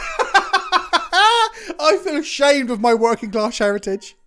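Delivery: slow words through real laughter; laughing. Real, not acted. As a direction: laugh it out at your own expense. Laughing at his posh tea.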